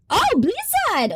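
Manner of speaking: high, sudden cry of recognition; delighted surprise. Real, not acted. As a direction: exclaim high, happily caught off guard. Spots a supporter's gift.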